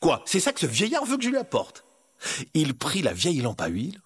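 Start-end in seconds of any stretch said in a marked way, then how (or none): 0.0-1.9 put-on high, baffled young hero; incredulous. Aladdin sees the lamp the old man wants, incredulous. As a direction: question it in disbelief, pitched up.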